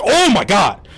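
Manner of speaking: high, loud "oh my God" of disbelief; exasperated. Real, not acted. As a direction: throw up your hands in outrage. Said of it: "I'm gonna get livid at certain points".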